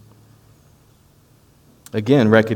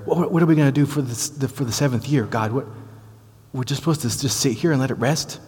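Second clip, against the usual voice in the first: higher, puzzled questioning to God; doubtful Israelites; 5 semitones higher than usual. Retold or imitated. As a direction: ask as a baffled people unsure of the plan. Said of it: "they're standing over there".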